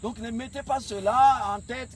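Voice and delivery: rally the team, full voice; roaring rallying speech. Acted. Coach exhorts young players.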